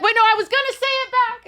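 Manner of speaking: high, laughing panicked voice; flustered. Retold or imitated.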